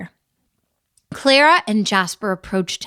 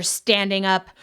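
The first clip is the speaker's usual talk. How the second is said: usual speaking voice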